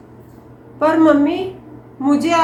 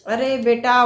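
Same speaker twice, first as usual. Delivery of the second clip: normal talking voice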